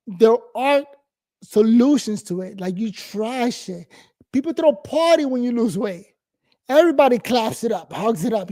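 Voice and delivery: usual speaking voice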